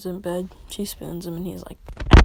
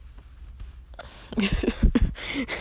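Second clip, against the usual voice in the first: wheezy burst of laughter; laughing; 3 semitones higher than usual. Real, not acted. Storyteller cracking up after his mock cheer, wheezy laugh. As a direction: break up laughing after the joke.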